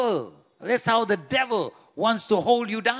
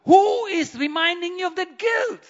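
high, loud, fiery preaching; preaching at full force; 9 semitones higher, 5 dB louder than usual. Acted. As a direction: rouse the congregation. Preaching climax.

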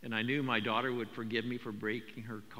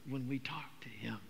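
soft unvoiced whisper of the key truth; 6 dB quieter than usual. Real, not acted. Pastor whispers to the congregation. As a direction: breathe it out like a secret.

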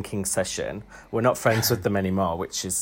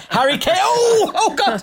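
loud, high mock orgasm shout; mock climax cry; 6 dB louder than usual. Mock climax shout of footballer names.

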